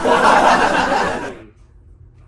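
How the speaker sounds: loud burst of laughter; loud laughing fit; big laugh. Real, not acted. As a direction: crack up loudly. Bursts out laughing mid-story.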